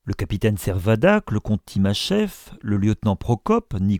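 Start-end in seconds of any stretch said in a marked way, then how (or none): none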